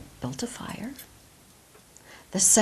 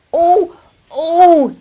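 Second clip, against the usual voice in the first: put-on high, loud eager old man; old man calling out; 10 semitones higher, 21 dB louder than usual. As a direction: shout it like an excited old man. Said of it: "said an old man".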